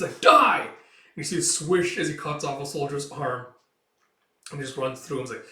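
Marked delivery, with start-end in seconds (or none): 0.2-0.6 high, loud savage yell; young fighter attacking; furious battle scream. Acted. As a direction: scream as you strike. Boy attacks soldier.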